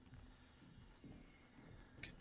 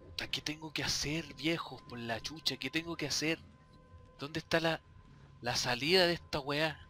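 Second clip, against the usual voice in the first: exasperated; 4 semitones higher, 5 dB louder than usual. Real, not acted. Desperate, asking viewers.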